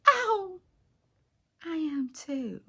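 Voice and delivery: high, soft, slow swoon; coy sweetheart. Retold or imitated. Ruth answering Boaz's declaration of love, coy and smitten. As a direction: answer softly in a lifted, lovestruck voice.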